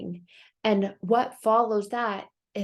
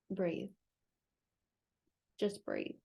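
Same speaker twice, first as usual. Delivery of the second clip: calming animals; 4 semitones lower, 7 dB quieter than usual. Retold or imitated. Other animals soothing panicked Chicken Little, soft calm reassurance. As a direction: talk her down gently. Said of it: "looking at Chicken Little like".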